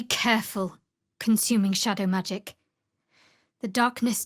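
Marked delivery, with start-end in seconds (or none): none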